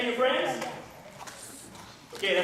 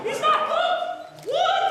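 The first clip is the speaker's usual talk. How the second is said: high, drawn-out fake shock; mock disbelief; much slower than usual. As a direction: ham up the outrage. Mascot mock outrage at boy.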